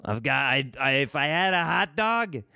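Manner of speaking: loosely faked New York Italian accent; half-hearted Italian New York accent; terrible Italian accent. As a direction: keep up a bad Italian New Yorker accent. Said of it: "you wouldn't even see this terrible accent".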